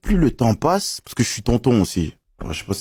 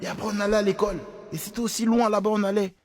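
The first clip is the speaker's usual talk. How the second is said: higher, softer voice of a mother recounting; imitating his mother; 7 semitones higher, 7 dB quieter, faster than usual. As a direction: play mum reminiscing, high and soft. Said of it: "Elle me dit".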